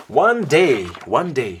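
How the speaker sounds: big booming "One day"; storyteller booming "One day"; loud dramatic opener. A narrator's reading.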